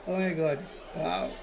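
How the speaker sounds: normal talking voice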